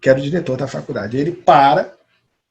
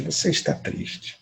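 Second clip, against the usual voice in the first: whispered; 2 semitones higher, 11 dB quieter than usual. Acted. Girl repeats, voice drops away.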